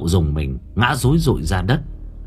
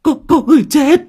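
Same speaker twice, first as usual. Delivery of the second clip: panicked stammer, much higher, building to a loud cry; 13 semitones higher, 4 dB louder, faster than usual. A narrator's reading. Servant stammering to villagers that someone died, panicked and high. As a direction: stutter out the news in terror. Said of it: "Thằng nhái lắp bắp".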